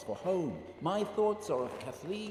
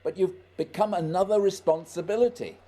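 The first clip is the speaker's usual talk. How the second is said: exasperated command; 3 semitones higher, 7 dB louder than usual. Acted. Scott ordering Barry to keep up, louder and exasperated. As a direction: bark orders over the wind.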